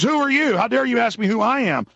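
high, indignant mock outrage; mock indignant agent. Host mocking the agent, high indignant outrage. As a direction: mimic the agent's offended squawk. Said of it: "He says".

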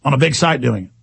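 ordinary talk, nothing marked

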